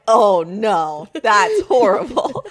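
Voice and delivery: mock sympathy while laughing. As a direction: fake pity, barely holding back a laugh.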